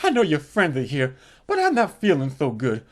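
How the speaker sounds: soft, low seductive coo; sultry murmur. Retold or imitated. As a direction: coax him into bed, softly. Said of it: "And she goes".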